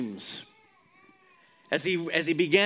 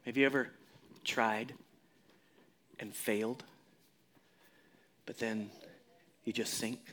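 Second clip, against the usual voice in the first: quiet reflection; 8 semitones lower, 7 dB quieter than usual. Real, not acted. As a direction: pull them in close, softly. Intimate confession to congregation.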